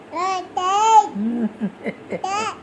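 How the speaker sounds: high bright burst of laughter. Real, not acted. Mother laughing at the parrot's shouting, high laughter.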